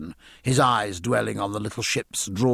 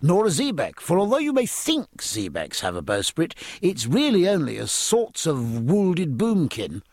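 higher-pitched, earnest explaining; keen midshipman earnestly explaining; 8 semitones higher than usual. A narrator's reading. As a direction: set him straight in all sincerity. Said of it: "they cried earnestly".

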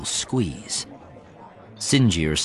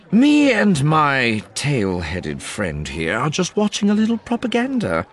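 sly mocking voice; 7 semitones higher than usual. A narrator's reading. Sinjir mocking the officers.

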